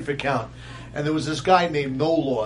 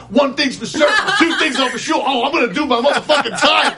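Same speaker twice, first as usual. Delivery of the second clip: loud, high-pitched catchphrase; high, loud mock-inmate patter; mimicking the inmate; 8 semitones higher, 5 dB louder than usual. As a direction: belt it out as a comic imitation. Mimics inmate's catchphrase back to him.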